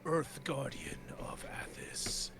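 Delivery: slow, hollow whispered giant's voice; slow whispered voice of a mountain spirit; earth guardian whisper. Acted. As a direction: breathe it out like ancient stone. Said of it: "heavily, uh, Terran accent".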